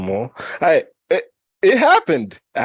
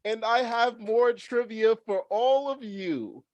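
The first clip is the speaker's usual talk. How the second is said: excited high voice; 10 semitones higher, slower than usual. Real, not acted. Excited host continues.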